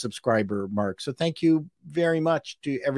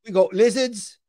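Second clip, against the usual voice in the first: higher mock British seller voice, eager; hammed-up British salesman; 8 semitones higher than usual. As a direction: keep pushing the sale in a silly British voice. Continues British salesman skit.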